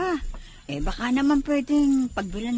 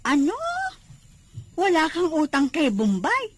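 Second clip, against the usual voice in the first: surprised; 7 semitones higher, 3 dB louder than usual. Acted. Anita shocked he has no debt.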